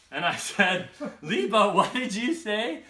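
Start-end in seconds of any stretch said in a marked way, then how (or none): 1.2-2.8 loud, high-pitched mock disbelief; incredulous question. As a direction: ask it as if you can't believe your ears. Startled reaction to friend's mispronunciation.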